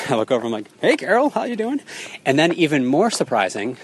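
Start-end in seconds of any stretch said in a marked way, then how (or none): 0.8-1.8 high, cheery hello, re-enacted; cheery called greeting. Runner greeting his business associate at mile six, high cheery call. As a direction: call back brightly in a raised pitch. Calling back to friend while running.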